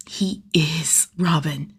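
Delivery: slow, breathy secret whisper; hushed and slow, savouring it; awed whisper. A narrator's reading. Secret thrill of being Robin.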